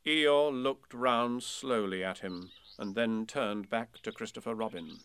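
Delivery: ordinary delivery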